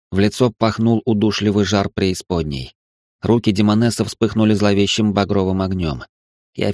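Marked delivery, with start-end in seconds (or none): none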